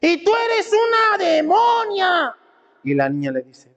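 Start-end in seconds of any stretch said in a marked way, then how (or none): 0.0-2.3 high, drawn-out furious yell; screaming husband. Acted. Husband yelling back at his wife, high drawn-out shout. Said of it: "el esposo le estaba gritando a la mujer".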